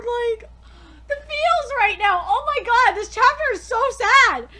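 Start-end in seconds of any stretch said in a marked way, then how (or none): none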